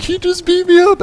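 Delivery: high, whiny schoolboy complaint; squeaky mock-pitiful wail; mock tattling victim. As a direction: imitate a beaten kid telling on someone. Imitates crying Matt tattling.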